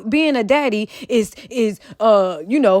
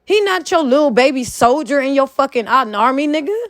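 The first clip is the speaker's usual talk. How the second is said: normal talking voice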